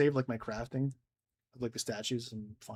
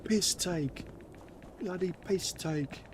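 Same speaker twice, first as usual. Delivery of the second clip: put-on British accent; 6 semitones higher, much slower than usual. Mock British banter.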